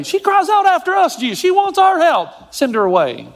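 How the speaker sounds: high, whiny mock complaint; a lightly voiced, petulant disciple. Preacher mimicking disciples complaining to Jesus, high and whiny. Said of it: "So the disciples say".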